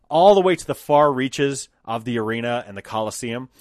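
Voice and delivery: ordinary talk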